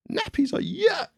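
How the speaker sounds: very high princess voice; cartoon princess. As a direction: squeak it like a little princess. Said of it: "said the Little Princess".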